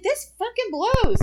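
loud, high, drawn-out retold gripe; exasperated outburst. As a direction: hammer it out slowly in mock outrage. Retold complaint about ruined date night.

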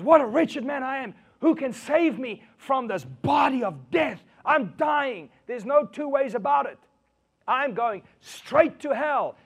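high, shouted lament; anguished Paul. Acted. Paul crying out to be saved, loud despairing shout. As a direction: shout in dramatic despair, stretching the key phrases.

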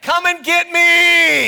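slow, high, loud taunt; stretched-out challenging shout; defiant call. Acted. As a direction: holler a drawn-out dare to the king. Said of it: "I would have said to the king".

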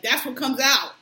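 usual speaking voice

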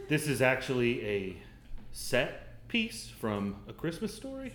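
very soft, low, halting murmur; hushed deadpan costume explanation; barely-there churchgoer voice with careful pauses; timid congregant. Acted. Congregant describing costume at Mass.

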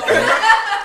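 ordinary talk

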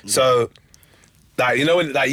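ordinary talk

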